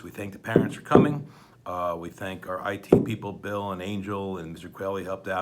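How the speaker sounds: ordinary delivery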